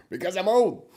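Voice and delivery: squeaky high mock whine. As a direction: pitch it way up and mock it. Mocking the driver's excuse.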